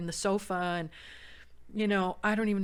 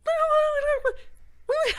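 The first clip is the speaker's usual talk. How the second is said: loud high laugh; 11 dB louder than usual. Retold or imitated. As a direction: burst of high disbelieving laughter. Recalling absurd moment.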